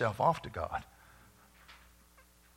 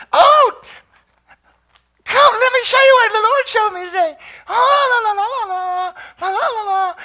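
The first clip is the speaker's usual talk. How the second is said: much higher, louder sing-song mock of his chirpy wife; mock cheery wife; 13 dB louder than usual. Preacher mimicking his wife's cheery morning chatter, high hammed-up sing-song. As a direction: ham up the overeager morning wife, breaking into la-la. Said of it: "She goes".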